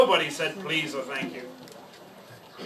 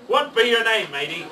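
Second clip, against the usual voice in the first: pirate asking; 6 semitones higher, 5 dB louder than usual. Acted. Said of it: "the head pirate asked".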